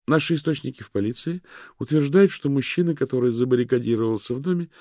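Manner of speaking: high, loud news anchor voice; TV news read. A narrator's reading.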